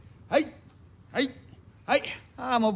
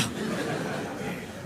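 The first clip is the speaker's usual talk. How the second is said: breathy wry chuckle; 9 dB quieter than usual. Acted. Bearer laughs at vague promise.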